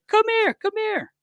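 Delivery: very high put-on goalie voice; imitated summons. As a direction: beckon urgently in a squeaky imitation. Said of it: "He's like".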